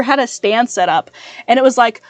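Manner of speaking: ordinary delivery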